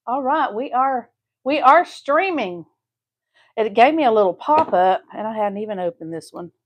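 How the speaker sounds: ordinary talk, nothing marked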